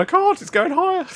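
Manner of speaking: very high mock-panic squeal; retold alarm, pitch right up; panicked. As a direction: protest helplessly in a hammed-up high voice. Retold panicked reply to calm down.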